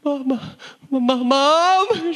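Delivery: high, whimpering cry for mom; hammed-up blubbering child on the phone; mock tearful call. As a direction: stammer it out high and weepy. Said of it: "those calls you give to your parents".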